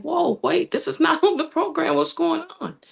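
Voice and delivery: high, startled whisper-question; surprised. Retold or imitated. Widow reacting as a dancer brings a crown, surprised. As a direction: caught off guard, bewildered. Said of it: "I'm looking like".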